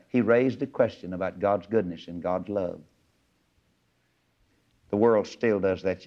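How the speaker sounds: ordinary delivery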